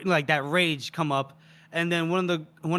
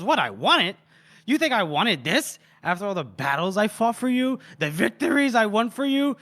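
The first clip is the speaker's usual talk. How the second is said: higher, mounting disbelief; incredulous; 5 semitones higher than usual. Acted. Mercenary throwing the duke's words back, incredulous. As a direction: build from stung disbelief into indignant protest. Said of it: "she's incredulous".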